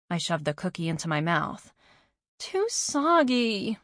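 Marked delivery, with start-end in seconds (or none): 2.4-3.8 slow moaning complaint. A narrator's reading. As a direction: drag it out in a moan. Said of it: "I moaned".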